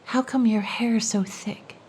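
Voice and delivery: whisper. Acted. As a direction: trade riddles in a hush. Whispered riddle dialogue.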